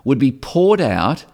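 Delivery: normal talking voice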